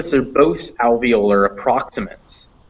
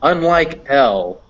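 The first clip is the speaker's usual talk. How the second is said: ordinary delivery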